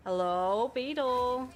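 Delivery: drawn-out singsong hello; playful calling. Pretend. Player calling the beetle they are seeking, singsong. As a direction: call out to a hidden creature, stretching each word.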